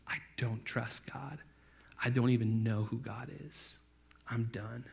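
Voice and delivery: low, hushed acted denial; quiet despairing denial. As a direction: drop to a soft, defeated voice. Voicing Peter's despairing denial.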